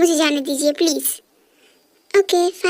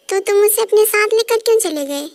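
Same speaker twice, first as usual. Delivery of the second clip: raised, higher accusing voice; angry accusation; 4 semitones higher, 3 dB louder than usual. Acted. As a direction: confront him, pitch climbing in anger. [name] accuses [name] of taking diary.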